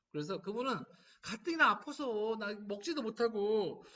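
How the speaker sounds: normal talking voice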